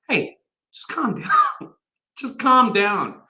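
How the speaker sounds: high, slow, half-laughing calm down; a light brush-off to panicking people; Moses telling them to calm down. Retold or imitated. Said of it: "the response that Moses says is".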